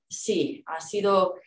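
normal talking voice

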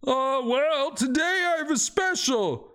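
mock the customers from below; high-voiced shopkeeper snarking at lazy buyers; grumpy cave merchant. Merchant lists his wares.

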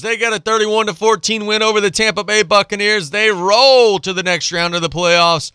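high-pitched, hyped highlight call; pumped-up sportscast delivery, pitched high; excited recap. Acted. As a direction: ride the energy of the big win. Excited highlight recap after the yell.

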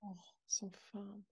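unvoiced rueful whisper, slow; whispered admission. Real, not acted. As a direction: breathe it out in shame. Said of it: "whispered agreement".

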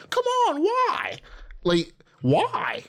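very high whiny mock complaint. Girl pulled over complaining to cop, high whiny voice. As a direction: squeak out an indignant girly whine.

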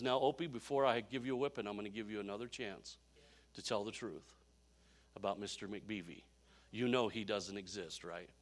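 low, soft, measured fatherly warning; imitated stern but gentle father; grave father. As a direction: speak quietly, like a dad giving one last chance. Father's grave talk before a whipping.

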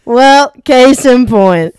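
slow, loud smug verdict; smug rep. Pretend. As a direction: drag it out with smugness. Rep mocking the customer.

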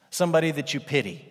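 usual speaking voice

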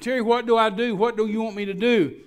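high, loud mock panic; imitating crying wife. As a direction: wail it frantically, as a retold plea. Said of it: "she's crying".

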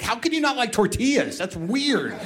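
high mock-incredulous girlfriend voice; a hint of a teasing girlfriend.

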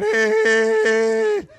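mock teen squeal of hey; squeaky hey. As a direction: let the voice climb into a boy's falsetto. Son's unbroken voice mocking.

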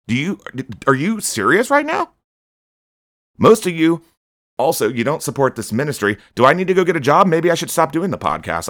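exasperated, voice climbing; indignant outburst. Real, not acted.

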